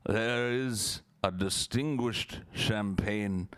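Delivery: slow, drawn-out grandiose orator with a high swooping start; lofty, lingering ad-pitchman delivery; Orson Welles. Acted. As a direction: savour each word pompously, stretched out. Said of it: "Do your best Orson Welles".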